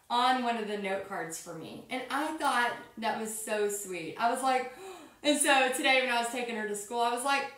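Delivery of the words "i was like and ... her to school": ordinary talk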